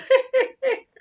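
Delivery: loud, high giggle; real laughing fit; high burst of laughter. Real, not acted. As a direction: crack up laughing. Laughing after the threat.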